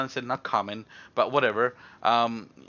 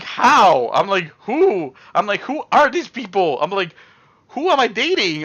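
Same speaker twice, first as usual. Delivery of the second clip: high, loud baffled questions; incredulous; 5 semitones higher, 5 dB louder than usual. Retold or imitated. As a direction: demand answers in mock disbelief. Acting his disbelief at dating rumours.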